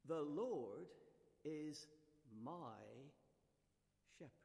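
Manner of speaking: "The Lord is my shepherd" with a pause after each word, "Lord" lifted; slow counted recital. Shown as a demonstration. Preacher demonstrating the finger device to the congregation, slow and spaced. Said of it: "encouraged to remember it using this little device".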